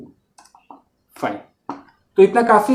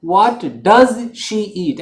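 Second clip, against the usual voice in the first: slow question demo; much slower than usual. Shown as a demonstration. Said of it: "अगर ये कोई सवाल होता तो पता कैसे होता?".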